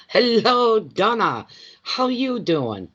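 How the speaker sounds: high, bright hello that slows at the end; cheery greeting. Real, not acted. Host welcoming Donna to the stream, cheery. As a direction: sing out a welcome to a friend.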